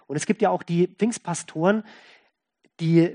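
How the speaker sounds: ordinary talk, nothing marked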